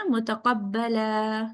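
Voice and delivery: very slow, stretched recitation of متقبلا. Shown as a demonstration. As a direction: draw the word out, then stop. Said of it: "آپ نے stop کرنا ہے اس کو".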